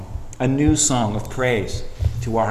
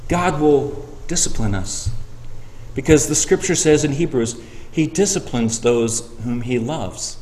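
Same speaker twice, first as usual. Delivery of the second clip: usual speaking voice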